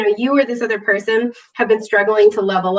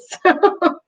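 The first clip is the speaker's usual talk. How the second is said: short high giggle; embarrassed giggle; 8 semitones higher than usual. Real, not acted. Laughing about needing to pee.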